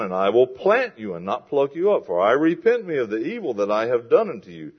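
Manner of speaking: loud, declamatory Bible reading; scripture reading. Acted. Preacher reads God's promise to his congregation, declaimed scripture. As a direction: proclaim the verse with weight and volume.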